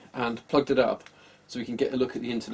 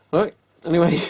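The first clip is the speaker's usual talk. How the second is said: laughing sign-off; 7 semitones higher, 6 dB louder than usual. Real, not acted. Signing off after the discovery.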